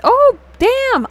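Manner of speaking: high, loud burst of surprise; sudden realization. Real, not acted. Streamer suddenly remembering a forgotten promise, startled outburst. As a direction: blurt it out as it suddenly hits you.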